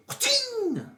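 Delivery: very high "ka-ching"; imitated shot sound. Player popping an enemy with a bow, high-pitched sound effect. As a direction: voice the arrow going off in a high ping.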